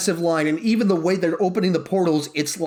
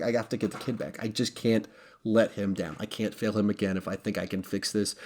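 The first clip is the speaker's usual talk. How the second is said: much softer, low, earnest inner vow; desperate resolve; 7 semitones lower, 8 dB quieter than usual. Retold or imitated. Tony's private vow to bring the kid back, soft and intense. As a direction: murmur it like a promise to yourself. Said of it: "the reason he would be willing".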